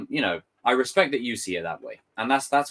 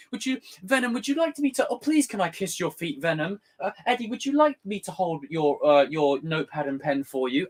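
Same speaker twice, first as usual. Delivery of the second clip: put-on simpering boyfriend; 6 semitones higher than usual. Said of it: "like, the".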